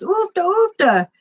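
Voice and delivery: a mild mock cry of "Oofta, oofta" to make the camel go; urging oofta. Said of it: "and the guy goes".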